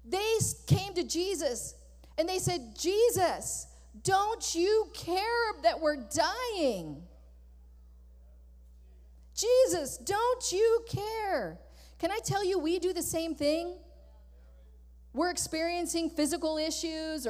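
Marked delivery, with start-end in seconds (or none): 2.8-11.6 higher, slow, pleading cry; panicked plea. Retold or imitated. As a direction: drag it out like a frightened whine. Disciples panicking in the storm.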